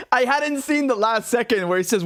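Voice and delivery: ordinary talk, nothing marked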